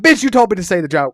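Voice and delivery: high loud exasperated snap; indignant retort. Retold or imitated. Comic answering the passenger, loud exasperated retort. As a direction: fire back in mock outrage. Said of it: "And I'm like".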